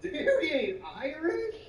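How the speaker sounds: high, slow mock disbelief; incredulous retort. Answering Miss Janet with high slow disbelief.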